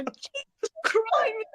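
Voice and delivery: frustrated coach impression. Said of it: "like, we're".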